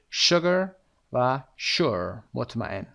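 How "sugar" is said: "Sugar" opening with a strong "sh", rising at the end. Shown as a demonstration. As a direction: lean into the "sh" of "Sugar".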